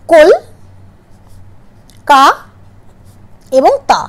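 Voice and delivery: কল, কা, তা split with long pauses; syllable by syllable. Shown as a demonstration. Teacher splitting Kolkata into syllables for students, long pauses between. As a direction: break Kolkata into its three syllables with clear gaps. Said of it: "Kolkata শব্দটার দল বিভাজন করি".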